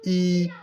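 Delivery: ई held long; lengthened vowel. Shown as a demonstration. Teacher showing how the long sound goes, ई stretched out. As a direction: draw ई out as one sustained ee. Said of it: "इसको थोड़ा सा लंबा करना है".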